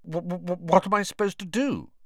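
nervous stammer on the start. A narrator's reading. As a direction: stumble over the first word. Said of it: "he stammered".

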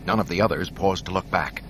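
plain reading voice

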